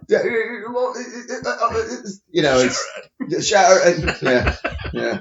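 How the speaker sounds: high, stuttering British rocker impression; mock mumbling British rock star. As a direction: stammer through it, barely getting words out. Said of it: "[name] is basically [name]".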